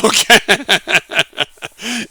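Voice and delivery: laughing it off; chuckling through okay; nervous laugh then okay. Real, not acted. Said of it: "I'm laughing about it".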